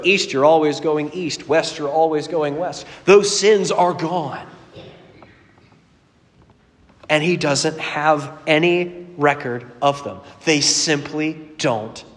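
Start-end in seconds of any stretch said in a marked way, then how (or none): 3.0-12.0 slow emphasis. Real, not acted. Sermon climax, slow emphasis.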